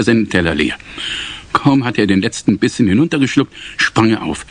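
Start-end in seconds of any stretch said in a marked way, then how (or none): none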